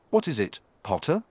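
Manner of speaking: angry questioning. A narrator's reading. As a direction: demand answers with a touch of anger. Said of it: "he asked angrily".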